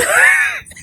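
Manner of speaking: loud, high burst of laughter. Real, not acted. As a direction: crack up at yourself. Laughs at her own boast.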